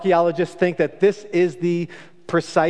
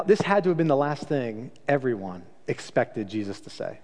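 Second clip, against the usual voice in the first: normal talking voice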